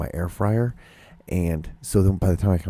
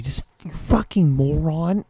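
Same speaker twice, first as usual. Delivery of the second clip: higher, slower fed-up insult; exasperated insult; 8 semitones higher, slower than usual. Retold or imitated. At the driver blocking the light, higher fed-up insult. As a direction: spit it out at the driver, done with them. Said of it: "And I'm like".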